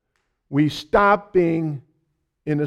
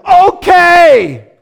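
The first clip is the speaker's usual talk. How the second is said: much higher, loud exasperated okay; 11 dB louder than usual. Retold or imitated. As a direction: blurt it out to stop the loop. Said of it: "out loud".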